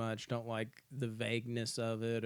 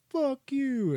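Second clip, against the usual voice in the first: much higher and louder curse; mock angry yell; 9 dB louder than usual. Morgan yelling at the sheriff as he pulls the trigger. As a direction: bark the curse way up high.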